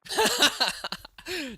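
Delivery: high burst of laughter. Real, not acted. Streamer amused by wordplay in chat, laughing. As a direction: laugh out loud at a pun.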